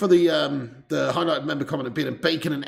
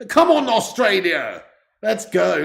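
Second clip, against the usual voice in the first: loud, slow, raised cheer; rallying cheer; 4 semitones higher, 6 dB louder, slower than usual. Real, not acted. Fan urging Australia to score, rallying cheer. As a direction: holler them on, stretching it out.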